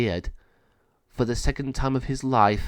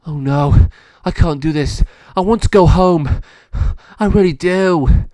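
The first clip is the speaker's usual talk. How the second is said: higher, much louder frightened pleading; panicked; 7 semitones higher, 11 dB louder than usual. A narrator's reading. Monty panics in the pound, louder and higher. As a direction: cry out in terror to go home.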